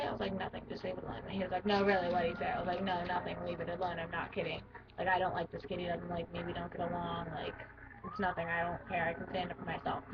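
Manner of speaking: ordinary talk, nothing marked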